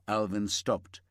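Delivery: ordinary delivery, nothing marked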